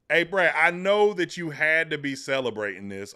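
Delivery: ordinary talk, nothing marked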